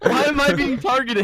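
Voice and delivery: high whiny protest; mock whine. Real, not acted. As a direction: whine it like a victim. Mock whining at teasing.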